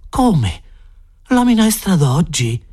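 astonished question. A narrator's reading. Scullery boy questions the cook about today's soup, astonished. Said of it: "Lo sguattero sgranò tanto d'occhi".

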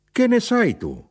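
high, loud, indignant question; shouted challenge. A narrator's reading. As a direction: fling it back as a high cry. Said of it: "gridò".

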